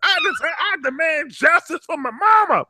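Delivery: high, loud hammed-up shouting; over-the-top falsetto yelling for justice; mock hollering. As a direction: holler it like a staged protest. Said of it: "She's hollering".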